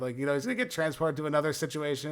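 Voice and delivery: ordinary talk, nothing marked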